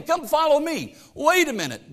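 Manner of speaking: usual speaking voice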